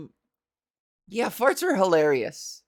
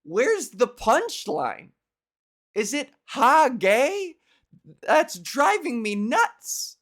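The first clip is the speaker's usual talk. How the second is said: higher, slow, mock-exasperated musing; drawn-out, baffled griping about a lazy joke; a hammed-up comic whining about the punchline; exasperated comedian; 6 semitones higher, slower than usual. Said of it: "my comedian sensibility is like".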